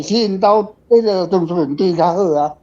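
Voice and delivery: ordinary delivery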